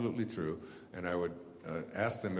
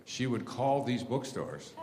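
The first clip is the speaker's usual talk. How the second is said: ordinary talk, nothing marked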